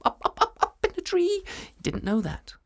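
ordinary delivery

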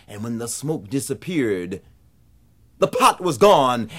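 ordinary delivery, nothing marked

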